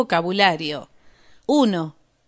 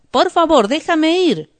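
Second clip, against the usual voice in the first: higher and louder, begging; pleading; 8 semitones higher, 5 dB louder than usual. Acted. Burglar begging the detective to release him, high plea. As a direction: beg him desperately to let you go. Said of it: "pide el hombre".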